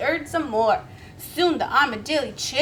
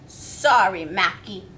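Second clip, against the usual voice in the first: snapping; 6 semitones lower, 4 dB louder than usual. Acted. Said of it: "snapped Miss Billy".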